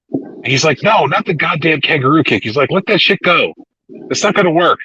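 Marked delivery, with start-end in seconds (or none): 0.8-2.4 raised-pitch retold refusal; exasperated imitated objection; mock exasperation. Retelling exasperated refusal of silly move. 2.7-4.8 high, insistent retold dismissal; pleading-annoyed impersonation; mock exasperation. Retelling exasperated refusal of move.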